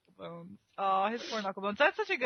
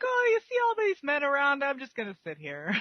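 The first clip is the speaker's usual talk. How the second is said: mock coy woman; 7 semitones higher than usual. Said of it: "she's kinda like".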